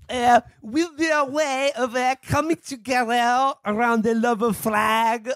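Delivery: mock French accent, slow and high. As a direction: ham up a French accent reading the quote. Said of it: "I'm not gonna do the French accent anymore".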